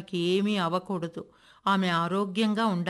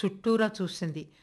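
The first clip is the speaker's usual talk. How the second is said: ordinary delivery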